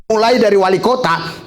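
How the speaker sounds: usual speaking voice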